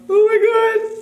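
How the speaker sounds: very high, loud squeal; alarmed squeal. Real, not acted. Vlogger unnerved by the loud cutting sound, high alarmed squeal.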